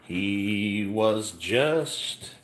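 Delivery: high slow dramatic trailer voice; movie trailer narration. Acted. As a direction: intone it like an epic trailer. Narrates mock trailer over music.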